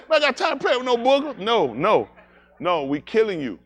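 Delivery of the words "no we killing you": ordinary talk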